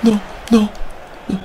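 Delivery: high, loud cries of despair fading down; anguished wail. Real, not acted. Gamer crying out after his striker misses, anguished. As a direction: wail in disbelief.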